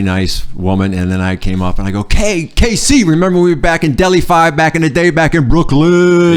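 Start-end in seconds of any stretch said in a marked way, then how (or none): 2.0-6.4 high, loud rap-style holler; boisterous high-pitched hype shout; hammed-up call-out. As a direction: belt it out, stretching the last word. Acts out calling to Casey.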